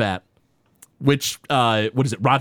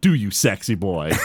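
menacing cop; 9 semitones lower than usual. Retold or imitated. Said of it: "cop Lou threatening".

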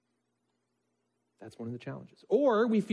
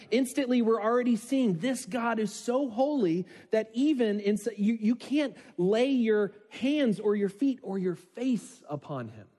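high, building excited preaching; rising awe; 5 semitones higher than usual. Acted. Preacher building awe at God's holiness, excited.

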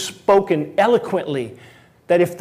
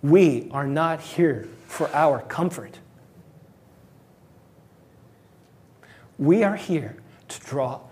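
words catching in the throat; choked up; slower than usual. Real, not acted. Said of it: "Sorry, I'm choked up".

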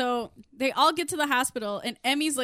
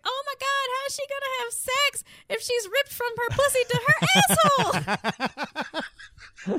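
high horrified voice, laughing through; high horrified gasp; 12 semitones higher, 5 dB louder than usual. Retold or imitated. Retelling Connie's horrified remark, high laughing squeal. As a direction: squeal it in horror. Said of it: "Connie's like".

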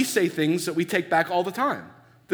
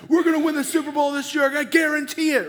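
cocky athlete boast; 11 semitones higher than usual. Retold or imitated. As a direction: brag like a sure-of-himself star player. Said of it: "you get the guy that's like".

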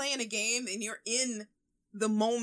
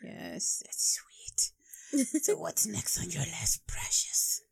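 creepy whisper; 3 semitones lower, slower than usual. Pretend. Gollum-like teasing whisper.

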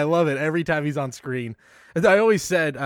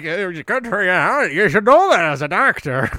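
tipsy old congressman, a bit louder and in a put-on voice; Stephen Hopkins; 3 semitones higher, 6 dB louder than usual. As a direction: play the jolly drunk defending his rum. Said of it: "I love that he's like".

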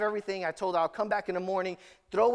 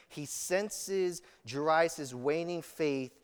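ordinary delivery

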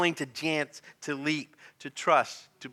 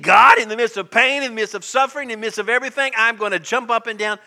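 loud, raised cry of faith; impassioned preaching; 7 semitones higher, 11 dB louder, faster than usual. Acted. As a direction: declare it to God at full force. Preaching at full force.

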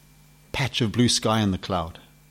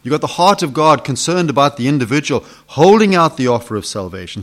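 normal talking voice

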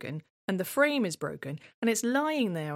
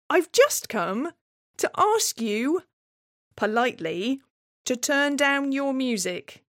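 overly polite request; 6 semitones higher, 4 dB louder than usual. Retold or imitated. Asking dwarf politely.

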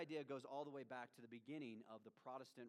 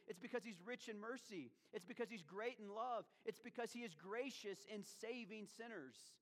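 raised-pitch emphatic preaching; 8 semitones higher than usual. Retold or imitated. Preacher gives Paul's reasons for God's mercy, emphatically. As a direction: drive each reason home to the congregation. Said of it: "Paul says it emphatically".

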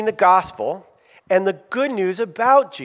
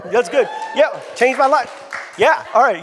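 excited shouting; 6 semitones higher than usual. Real, not acted. Pastor cheering crowd answers.

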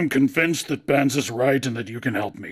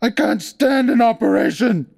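high, loud panicked cry; terrified cry; 12 semitones higher, 7 dB louder than usual. A narrator's reading. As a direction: beg in terror. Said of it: "shrieked Clagett".

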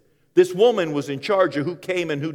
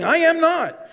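much higher, louder flat denial; 6 dB louder, slower than usual. Acted. Peter denying the servant, loud and high. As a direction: throw the denial back hard.

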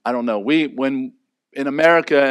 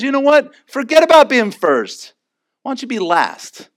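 Jesus challenging; 9 semitones higher, 4 dB louder than usual. Acted. Jesus voiced giving the lesson.

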